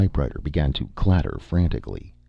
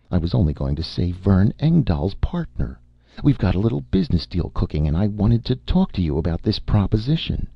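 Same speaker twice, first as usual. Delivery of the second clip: higher, brisk, teasing woman's voice, climbing higher on her name; a pert young woman brushing off the detective, higher and quicker; Amy, a cool brash young woman; 7 semitones higher than usual. Acted. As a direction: play her self-assured and a little mocking.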